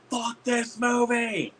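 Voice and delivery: high, drawn-out heckle; mock shout. Moviegoer standing up as the film ends, mock shout. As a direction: holler it high and stretched, as a heckler would. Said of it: "stand up and shout that".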